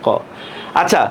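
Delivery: ordinary delivery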